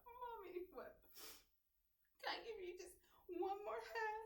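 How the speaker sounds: put-on high soft child voice asking for a hug; little girl pleading. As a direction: plead sweetly like a small kid.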